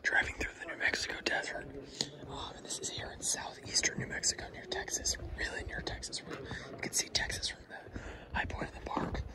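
hushed awed cave whisper. Real, not acted. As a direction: whisper softly, full of wonder.

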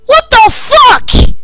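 high, loud and drawn-out slow; shocked yell. Real, not acted. Streamer startled by a monster, slow shocked yell. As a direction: stretch it out in shocked fright. Jump scare reaction.

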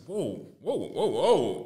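drawn-out amazed whoa; mock astonished whoa, lightly voiced; retold whoa. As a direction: stretch each whoa in shock. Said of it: "I said".

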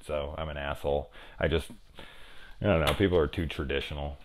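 ordinary talk

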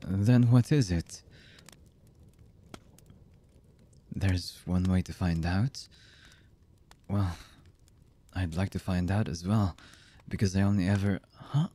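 slow, halting lover's voice with long pauses; hesitant, emotional confession. Acted. Lover confessing horrible deeds and love to the beloved, halting and emotional. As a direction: confess haltingly, one fragment at a time. Voice actor's romantic audio roleplay.

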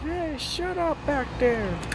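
slow, soft, as if shouted from far off; a far-off neighbor hollering to shut up; distant neighbor yelling. Acted. Neighbor yells from next door, slow and distant.

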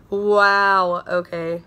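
ordinary delivery

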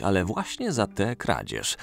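usual speaking voice

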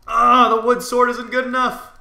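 high, loud exasperated yell; loud gamer frustration; frustrated shout. Real, not acted. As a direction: cry out as the prey gets away. Opponent escapes during the fight.

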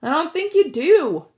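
Percy shouting back to Annabeth; shouted back. A narrator's reading. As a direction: yell it back across the bubbles. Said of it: "I shouted back".

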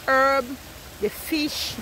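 ordinary talk

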